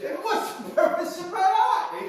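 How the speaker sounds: loud, high-pitched call-out; rallying call. Pretend. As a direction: fire the question at the crowd. Rousing call to the group.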